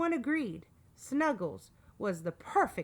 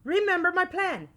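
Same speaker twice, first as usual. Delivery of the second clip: loud, high-pitched reminder; shouted; 9 semitones higher, 8 dB louder than usual. A narrator's reading. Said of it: "shouted Al".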